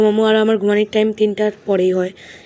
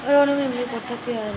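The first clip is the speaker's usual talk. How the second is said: speaking softly; 9 dB quieter than usual. Real, not acted. Student vlogging while mom is on the phone, speaking softly. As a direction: keep it down so nearby phone talk isn't disturbed. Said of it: "আমি এত আস্তে কথা বলতেছি".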